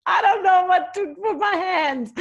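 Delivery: high, loud frightened shout; panicked shout. Retold or imitated. Stuck climber shouts down in panic, high and loud. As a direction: yell it in a panic, stuck on the rock.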